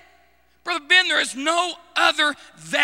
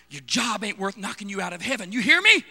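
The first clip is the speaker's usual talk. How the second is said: ordinary talk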